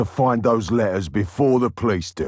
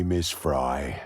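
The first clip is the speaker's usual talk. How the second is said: ordinary delivery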